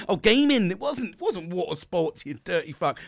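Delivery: high, loud eureka; mock outrage at his mate's joke; sudden realization. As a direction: blurt it out as it clicks.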